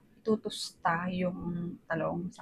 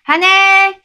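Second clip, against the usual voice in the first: calling out loudly to the next room; yelling across the room; 12 semitones higher, 20 dB louder than usual. Real, not acted. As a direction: shout for them to come eat. Calling spouse to dinner.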